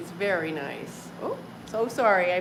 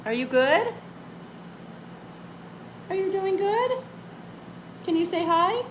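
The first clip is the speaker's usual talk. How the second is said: high sing-song coaxing voice to a pet; 12 semitones higher than usual. Real, not acted. Owner coaxing her cat Pumpkin to say hi, high baby talk.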